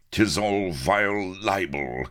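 venomous, drawn-out protest; hissed denial. A narrator's reading. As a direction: hiss it, stretching each word. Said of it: "hissed our Ben".

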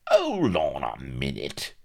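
squeaky sidekick cutting in; flustered character-voice interjection; Vladimir, flustered. Acted. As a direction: halt the talk in dismay.

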